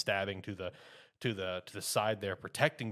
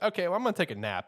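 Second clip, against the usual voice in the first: higher, fast and breezy; carefree; 10 semitones higher, 6 dB louder, faster than usual. Retold or imitated. Denji shrugs off being trapped, higher, fast and breezy. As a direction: toss it off like nothing matters. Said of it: "It's like".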